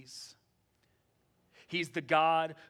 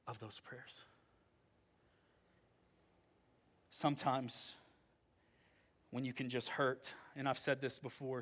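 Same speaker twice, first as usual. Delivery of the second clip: hushed preaching; 5 semitones lower, 10 dB quieter than usual. Acted. Pastor gently comforts hurting listeners, soft and hushed. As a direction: bring the room down to a tender near-whisper.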